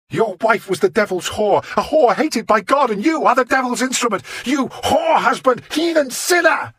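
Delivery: high, loud frenzied insults; howling abuse. Acted. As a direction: howl it while striking him. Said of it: "howling at me".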